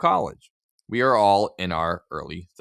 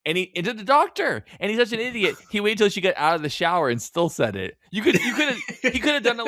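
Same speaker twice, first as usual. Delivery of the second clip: outraged; 10 semitones higher than usual. Real, not acted. Host ranting at the idiot husband, high outraged. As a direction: rant about him, pitched way up.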